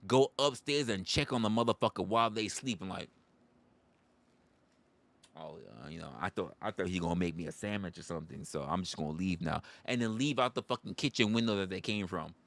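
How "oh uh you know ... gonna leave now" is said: sheepish mumble. Acted. Intruder caught, sheepish excuse.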